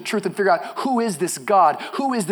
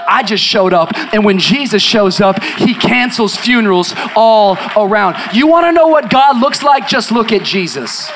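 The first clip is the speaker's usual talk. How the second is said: full-force preaching; 2 semitones higher, 9 dB louder than usual. Acted. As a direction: preach it out over the congregation.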